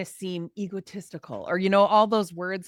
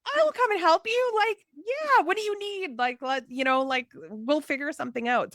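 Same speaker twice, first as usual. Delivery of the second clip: much higher, gushing offers of help; bright, much higher eager helper voice; 8 semitones higher than usual. Retold or imitated. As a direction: sound like a friend rushing to help. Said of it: "people are like".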